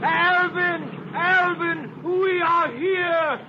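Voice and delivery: high, loud, slow call across the water; shouting to Alvin on the yacht. Acted. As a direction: hail him from the boat, drawn out. Calling across water to boat.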